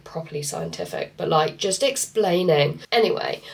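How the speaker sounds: normal talking voice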